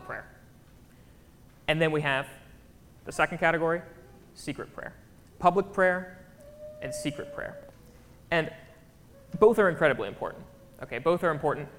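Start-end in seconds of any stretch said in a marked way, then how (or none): 4.3-4.9 secret prayer said in a whisper. Real, not acted. Names secret prayer, hushed. 6.8-7.6 hushed aside. Real, not acted. Names secret prayer, hushed.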